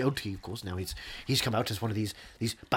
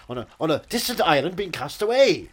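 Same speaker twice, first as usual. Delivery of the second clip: rising rant; 6 semitones higher, 7 dB louder than usual. Pretend. Building comic rant.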